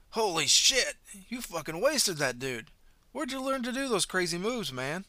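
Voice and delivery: loud, high amazed yelling; young bystander awed by the fight; excitable young man. Acted. Excitable young man shouts, amazed. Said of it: "the excitable young man".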